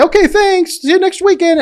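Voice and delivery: hammed-up cheery goodbye; breezy brush-off. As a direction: chirp it out to see her off.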